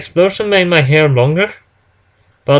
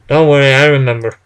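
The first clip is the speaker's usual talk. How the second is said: ordinary delivery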